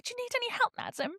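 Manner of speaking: soft, high-pitched polite gentleman; quietly polite offer; courteous gentleman. Retold or imitated. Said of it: "a normal gentleman would offer".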